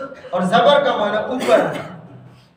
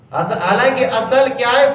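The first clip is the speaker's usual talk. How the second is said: ordinary talk, nothing marked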